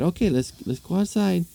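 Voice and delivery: high, lilting invitation; hint of a woman's high voice; lightly voiced woman.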